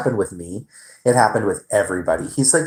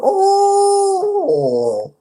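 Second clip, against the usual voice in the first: very high, loud squealy fake-friendly greeting; imitating the manager; 5 dB louder than usual. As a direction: parody the boss greeting customers. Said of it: "that's how he is".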